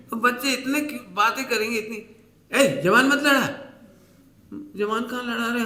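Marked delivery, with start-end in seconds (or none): 2.6-3.6 louder, higher mock scolding elder; hammed-up angry elder. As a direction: bark at the child to stop answering back. Elder scolding talkative child.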